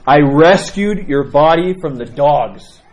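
loud, slow wail of grief; grieving mother lamenting at the saint's relics. Retold or imitated. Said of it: "she begins lamenting like crazy".

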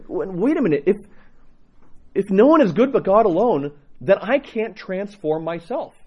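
a hint of startled, high disbelief; half-shaking off shock; stunned realization. Seminarian realizing he cannot save himself, lightly stunned. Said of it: "shook my head and go".

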